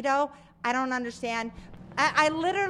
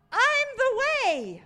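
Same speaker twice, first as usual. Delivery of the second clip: tender Jesus voice; 9 semitones higher, 6 dB louder, much slower than usual. Acted. Voicing Jesus reassuring Thomas.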